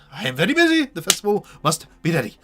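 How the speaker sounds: high, loud flustered official brushing them off; busy official. Acted. GM voices busy official NPC.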